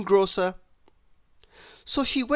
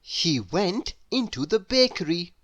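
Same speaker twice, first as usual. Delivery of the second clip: ordinary delivery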